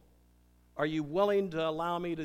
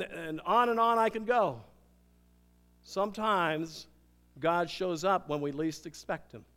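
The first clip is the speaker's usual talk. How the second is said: usual speaking voice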